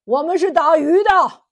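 fishermen's defiant holler; shouted reply. A narrator's reading. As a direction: shout it back boldly across the water. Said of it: "七兄弟齐声喊".

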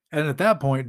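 ordinary delivery

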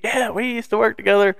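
high-pitched eager retelling; imitated excited friend. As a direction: pipe up keenly as the friend.

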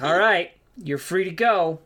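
gruff dismissal from a guard; lightly voiced guard. Guard NPC dismisses party.

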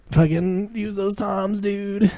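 imitating the bandmate's catchphrase, slowed down; mock dude voice. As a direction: drag it out mocking him. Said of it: "He's like".

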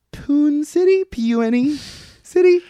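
"Poon City" said high and questioning; tentative high-pitched spelling of the city name; unsure pronunciation. Shown as a demonstration. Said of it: "I don't know how to pronounce it".

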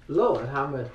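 ordinary talk, nothing marked

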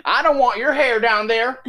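hammed-up Southern accent through laughter. Host joking about breaking the mustache law, Southern accent. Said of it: "he uses the same accent for Texas".

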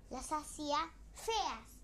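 ordinary delivery

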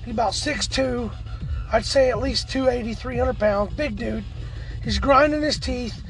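ordinary talk, nothing marked

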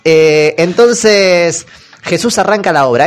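ordinary talk, nothing marked

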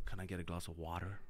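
low, soft British accent like a spy; suave British accent. Shown as a demonstration. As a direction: order water like James Bond. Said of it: "Now I feel like double oh seven".

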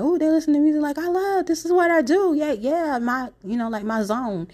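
high, excited and gushing; excited and delighted. Retold or imitated. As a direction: gush like you found your people. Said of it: "and I'm like".

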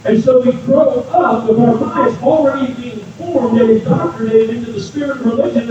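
normal talking voice

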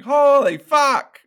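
loud, high, drawn-out amazement; amazed exclamation. Retold or imitated. Chewie walks into the rich family's shed, amazed. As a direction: act out being floored, stretching the words way up.

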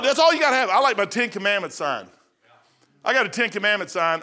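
ordinary talk, nothing marked

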